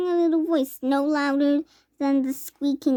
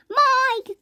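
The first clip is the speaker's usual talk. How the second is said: high anxious cry to her son; frantic mother's call; 10 semitones higher, 4 dB louder than usual. A narrator's reading. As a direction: call his name, alarmed mother. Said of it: "cried Missus Teavee".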